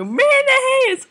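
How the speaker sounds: very high, loud squealed yell; silly high-pitched shout; joke yell. Pretend. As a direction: shriek the inside joke across the field. Proposing the inside-joke cry.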